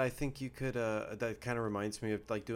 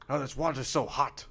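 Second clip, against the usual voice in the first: mock-formal scholarly voice, a touch louder; Renaissance scholar voice; 5 semitones higher, 5 dB louder than usual. A man complaining to his talking sink in a hammed-up scholarly voice. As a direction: play a pompous old-world scholar complaining about the water.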